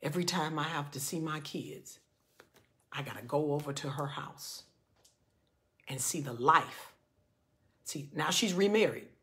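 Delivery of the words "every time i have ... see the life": low, very soft, halting regret with long pauses; almost in tears. Retold or imitated. Divorced father describes visiting kids, retold as almost in tears. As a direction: imitate a broken-hearted man, subdued. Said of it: "he's almost in tears".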